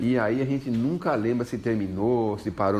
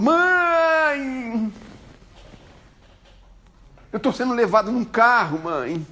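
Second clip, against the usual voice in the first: high, loud cry to his mother; panicked son; 11 semitones higher, 4 dB louder, much slower than usual. Retold or imitated. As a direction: call out to mum as if in danger. Son phoning mother in distress.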